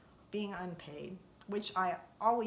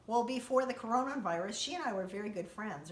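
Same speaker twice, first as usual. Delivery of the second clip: normal talking voice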